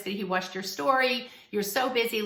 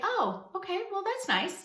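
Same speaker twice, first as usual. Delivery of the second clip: airy and higher, as if it barely matters; breezy unbothered reply; 9 semitones higher than usual. Pretend. Friend reports the ex asked, reply breezy and unbothered. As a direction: shrug it off lightly, pitched up.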